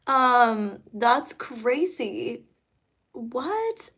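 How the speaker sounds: ordinary talk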